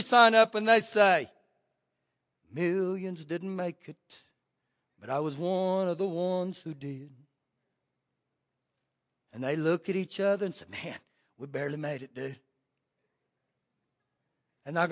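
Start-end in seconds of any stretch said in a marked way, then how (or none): 2.5-7.1 awed saints. Acted. Saints in heaven speaking. 10.7-12.4 relieved saints. Acted. Saints relieved to each other.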